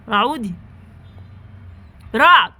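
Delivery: loud call of a name. Real, not acted. Mother calling her son Raad who is asleep, loud call. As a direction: call him loudly across the room.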